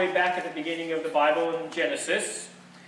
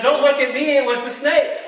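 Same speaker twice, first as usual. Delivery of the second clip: higher and louder, pointing the finger; 7 semitones higher, 8 dB louder than usual. Acted. Preacher voicing Eve blaming the snake, loud and high. Acted Eve passing the blame.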